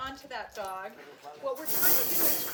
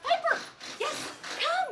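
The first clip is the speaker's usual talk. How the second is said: calling the dog; 12 semitones higher, 10 dB louder, slower than usual. Shown as a demonstration. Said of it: "don't wag your treats".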